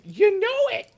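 high, loud mock squeal of a fan; excited squeal. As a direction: imitate a little sister freaking out. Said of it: "she just started wigging out".

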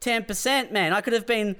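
mock outraged whine. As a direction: whine about the lost ten percent. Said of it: "You're like".

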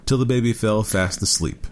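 usual speaking voice

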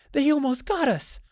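very high, frightened exclamation; tearful alarm. A narrator's reading. Wife still shaken after fleeing the lions, tearful and high. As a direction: cry it out as a narrow escape. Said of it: "and she was crying".

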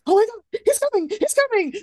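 high, frantic bird voices squealing in alarm; panicked birds. Retold or imitated. Bambi's birds panicking to the audience, high frantic squeal. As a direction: voice the birds shrieking that he's coming. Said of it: "the birds are like".

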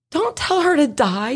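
playful mock outrage, pitched high; mock horrified protest. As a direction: drag out the shocked objection. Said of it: "And I'm like".

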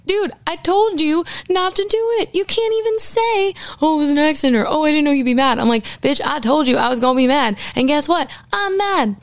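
hammed-up fury at a cheating boyfriend; mock angry rant. Retelling telling off cheating boyfriend.

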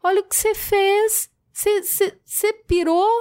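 high, stunned disbelief at a friend; hurt, incredulous scolding; shocked outrage. Retold or imitated. Confronting friend who stole.

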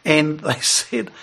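ordinary talk

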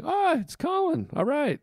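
very high, singsong delight; light up at seeing a friend's name; acted high-pitched happy relief; pleased greeting. Pleased reaction to a friend calling.

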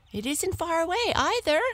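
high, fast hare voice; eager hare. A narrator's reading. Hare coaxes the lion, high and fast. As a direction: rattle it off high.

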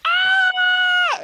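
very high startled scream; mock scream. Onlookers see the rat beaten, high scream. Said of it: "people were like".

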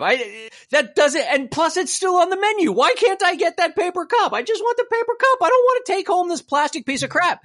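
begging the clerk to just give him the cup; exasperated pleading. Retold or imitated. Retold exasperated demand at counter.

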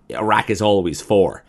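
lightly voiced pushy customer; imitating a customer. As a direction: play the stubborn diner laying down the law. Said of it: "she was like".

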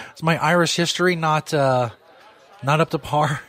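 ordinary delivery

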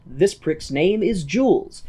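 ordinary talk

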